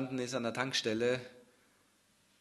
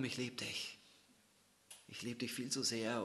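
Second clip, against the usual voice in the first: hushed loving words; 8 dB quieter than usual. Acted. Jesus's love, hushed.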